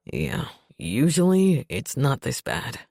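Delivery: slow, groggy deep voice; tired gruff man; low male voice. A narrator's reading. Narrator voices Revan.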